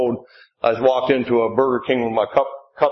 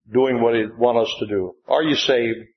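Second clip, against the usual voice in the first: ordinary talk, nothing marked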